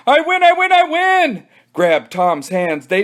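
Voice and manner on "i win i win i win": high, louder repeated yell; triumphant kid shouting; victory yell. Acted. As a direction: cheer your win out loud.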